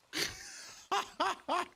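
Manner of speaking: high breathless giggle; scream into giggles. Real, not acted. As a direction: giggle off the scare. Laughs after the scare.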